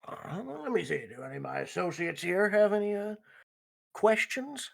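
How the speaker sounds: usual speaking voice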